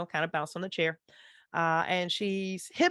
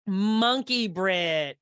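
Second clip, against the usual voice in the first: slow, drawn-out, emphatic repeat; savoured echo; 4 dB louder, much slower than usual. Real, not acted. Game master echoes the player's dessert answer, savouring it slowly. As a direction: stretch out each word with relish.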